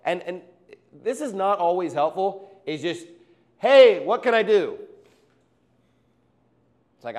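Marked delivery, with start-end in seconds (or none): 3.6-4.8 mock eager offer. Mock eager helper offering help.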